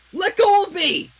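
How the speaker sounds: yelling. Acted. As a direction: shout while fighting them off. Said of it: "Princess Twilight yelled".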